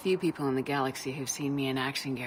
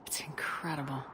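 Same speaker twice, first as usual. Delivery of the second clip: whispered, taken aback by the view; hushed amazement; awed whisper; 4 dB quieter than usual. Real, not acted. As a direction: breathe it out in wonder.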